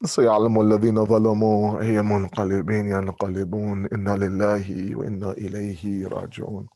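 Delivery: low, quick recited verse; solemn closing recitation in Arabic. Real, not acted.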